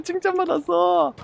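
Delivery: 웃음 섞어 되뇌기; 웃으며 흐뭇하게 반복; laughing. Real, not acted. (웃음 섞인 말투)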